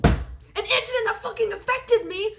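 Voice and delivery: high, loud outraged cry; furious shout. Real, not acted. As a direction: throw the words back at her. Outraged rant at her.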